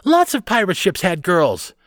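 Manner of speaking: put-on high, loud child arguing back; indignant little girl. A narrator's reading. Carley arguing with the boys, indignant.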